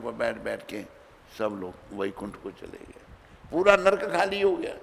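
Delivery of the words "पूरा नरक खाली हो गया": triumphant. A narrator's reading. Climax of the story.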